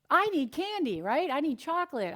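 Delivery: mock craving voice. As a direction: play the craving brain begging for sweets.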